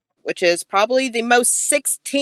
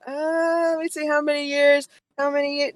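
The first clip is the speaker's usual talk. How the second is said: ditzy checking phone; 8 semitones higher than usual. Retold or imitated. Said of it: "be like".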